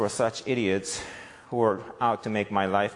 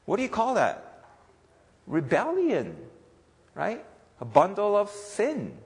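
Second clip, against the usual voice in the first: loud, high fervent sermon delivery; impassioned preaching; 13 semitones higher, 9 dB louder than usual. Acted. Pastor preaching to church, loud and fervent. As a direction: preach it with full force to the congregation.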